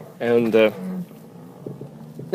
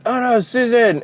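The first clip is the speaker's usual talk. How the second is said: mock alarmed cry; 13 semitones higher, 4 dB louder than usual. Peter retold alarmed in film.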